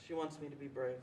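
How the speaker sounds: soft, subdued thinking to oneself; quiet inner thought. A narrator's reading. Child's inner thought, voiced softly.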